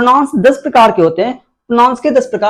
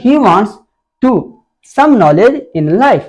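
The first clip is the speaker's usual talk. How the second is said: drawn out word by word with pauses; slow dictation; much slower than usual. Shown as a demonstration. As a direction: read the example slowly for the class. Teacher reads example sentence aloud. (धीरे-धीरे रुक-रुककर पढ़ना)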